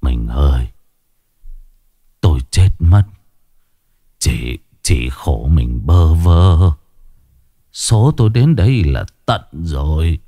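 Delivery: low, weak, fading words; a dying husband's last faint words to his wife; feeble dying voice. A narrator's reading. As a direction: barely get the words out, dying. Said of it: "nói thều thào yếu lắm".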